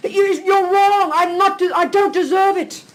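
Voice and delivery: high indignant protest; childish anger. Acted. Child protesting to mummy, mock indignant whine. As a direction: insist like a hurt kid. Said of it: "Is that not anger?".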